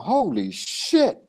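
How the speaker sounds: slow, drawn-out, high-pitched astonishment; amazed reaction. Retold or imitated. Recalling his research into world dialects, amazed reaction. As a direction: stretch out both words in retold amazement.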